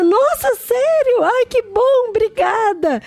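put-on high, slow, delighted girl's voice; grateful young girl. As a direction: play the relieved shop girl. Girl thanked for hair clip. (voz aguda e lenta de menina agradecida)

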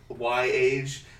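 normal talking voice

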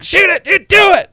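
high, loud mock yell; angry fan shout. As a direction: bark it like an angry hockey fan. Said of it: "it's not just like".

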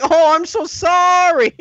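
high, slow mock apology; drawn-out squeaky parody of saying sorry; caricatured apologetic manager. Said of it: "He's like".

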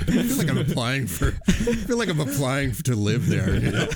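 helplessly amused, words riding on laughs; laughing. Real, not acted. Host joking about applying to the co-op, laughing.

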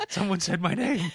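lightly voiced sad singer; a hint of a mopey rock star. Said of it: "[name] ... and went".

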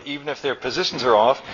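plain reading voice

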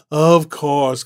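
loud, scornful mockery of her idea; sneering sarcasm. Randall mocking Annie's suggestion, loud sarcastic sneer.

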